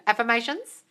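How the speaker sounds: ordinary delivery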